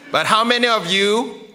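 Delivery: usual speaking voice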